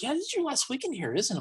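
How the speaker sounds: hint of a chirpy congregant; churchgoer quip. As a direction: imitate a churchgoer's offhand remark. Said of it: "some people are like".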